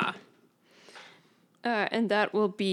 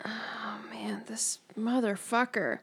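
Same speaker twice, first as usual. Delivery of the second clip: frustrated whisper; 8 dB quieter than usual. Real, not acted. Frustrated player muttering at monster.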